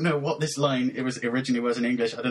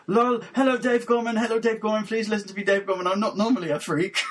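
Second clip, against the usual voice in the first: high, insistent mock fan pleading; gushing Twitter plea; 9 semitones higher than usual. Fan begging a comedian online to listen, high and over-eager. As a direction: plead in a raised, over-eager voice, laugh at the end. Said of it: "then you're on Twitter going".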